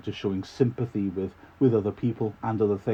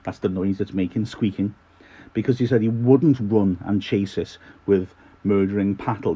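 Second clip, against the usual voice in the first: usual speaking voice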